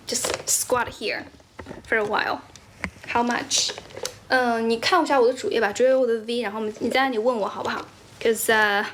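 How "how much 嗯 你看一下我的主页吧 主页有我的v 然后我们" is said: normal talking voice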